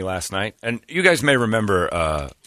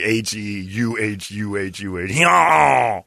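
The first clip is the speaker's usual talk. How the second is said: spelled-out laugh and hee-haw; slower than usual. Retold or imitated. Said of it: "it was like".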